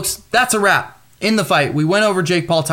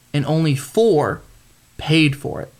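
ordinary talk

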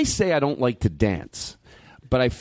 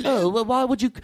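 dumb voice; 9 semitones higher than usual. Retold or imitated. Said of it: "and I do the dumb voice".

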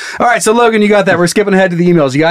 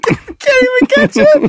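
words squeezed through laughter; laughing; much slower than usual. Real, not acted. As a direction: laugh while you say it. Laughing while joking.

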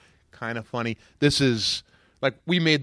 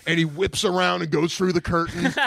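normal talking voice

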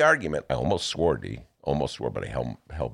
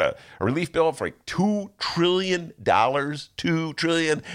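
normal talking voice

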